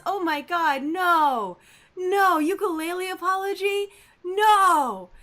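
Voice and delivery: loud, high cry of disbelief; real outraged shock; loud incredulous no. Real, not acted. Reacting to ukulele apology news.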